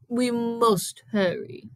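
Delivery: slow, measured urging; Missus Jeepers' character voice. A narrator's reading. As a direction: urge them on, stretching each word. Missus Jeepers urges the kids.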